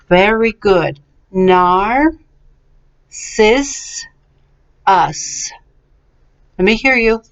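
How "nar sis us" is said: "Nar, sis, us", a long pause after each part; syllable by syllable. Shown as a demonstration. Teacher models for repetition, syllable by syllable. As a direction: break "Nar, sis, us" into separate slow beats. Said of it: "let's go a little bit more quickly".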